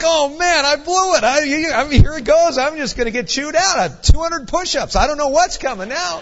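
loud, high, frantic inner monologue; hammed-up dread, tumbling over the words; panicked. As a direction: blurt it out, sure you're about to be chewed out. Acting out his panic at Romaine.

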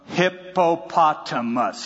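drawn out, sing-song and slow; Adam slowly sing-songing a long animal name; ambitious Adam naming. Retold or imitated. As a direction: stretch every syllable proudly. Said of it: "Okay, here goes".

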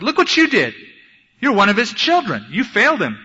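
high, loud, berating attack; Satan's accusation. Acted. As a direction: hurl blame at a cowering sinner. Satan accusing the believer, acted.

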